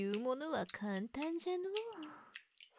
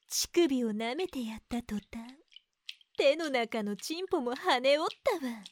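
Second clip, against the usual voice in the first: ordinary delivery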